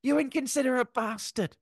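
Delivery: hammed-up furious shouting, very high; mock furious yell. Friend acting out the angry insults in the car, mock furious yell.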